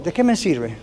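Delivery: ordinary talk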